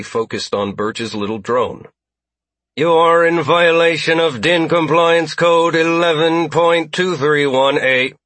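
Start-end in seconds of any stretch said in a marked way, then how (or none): 2.7-8.1 loud, high robotic announcement. A narrator's reading. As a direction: droid reading out a violation code. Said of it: "a robotic voice announced".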